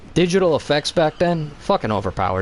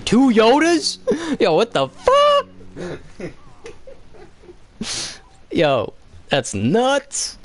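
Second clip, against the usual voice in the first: stunned disbelief; 12 semitones higher, slower than usual. Real, not acted. Reacting to something on screen, high laughing disbelief. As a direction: blurt it out, floored and laughing.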